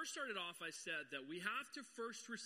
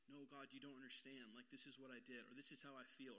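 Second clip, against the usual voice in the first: timid plea to God; 5 semitones lower, 9 dB quieter than usual. Retold or imitated. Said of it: "we're saying".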